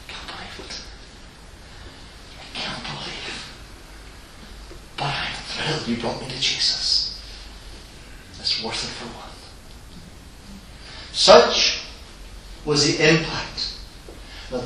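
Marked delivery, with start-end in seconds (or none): 0.1-9.3 hushed, breathless disbelief; awed whisper. Acted. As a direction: whisper it in wonder.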